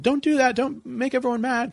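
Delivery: high, pleading mock voice of a timid bandmate; sensitive guy. Band member mocking the sensitive guy, in a high pleading voice. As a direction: squeak it out like the worried one. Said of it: "the guy that was kinda the most sensitive".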